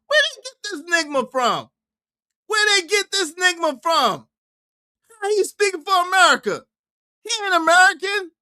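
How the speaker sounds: high-pitched, baffled protest; incredulous. Real, not acted. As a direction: question it in a high, disbelieving voice. Incredulous questions about the speaker.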